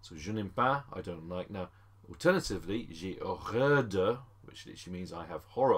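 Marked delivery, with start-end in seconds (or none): none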